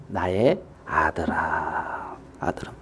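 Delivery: slow, low, near-whispered call; hushed fatherly soothing; tender father. Acted. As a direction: draw the address out very softly. Father addresses elder son.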